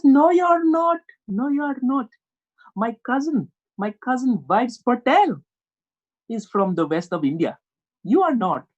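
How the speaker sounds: emphatic Indian dad contradiction; insistent Indian accent impression. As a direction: argue back in a high mock Indian accent.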